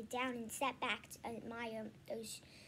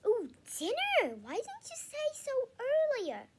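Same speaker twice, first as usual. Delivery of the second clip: high, louder, delighted; eager; 12 semitones higher, 6 dB louder than usual. A narrator's reading. Dad hears dinner is ready, high eager voice. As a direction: perk up at the thought of food.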